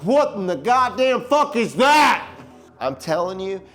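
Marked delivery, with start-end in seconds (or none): 0.0-2.3 high, loud burst of disbelief; slapping your partner and yelling in shock. Retold or imitated. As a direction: yell it like you just saw a monster. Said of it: "and I was like".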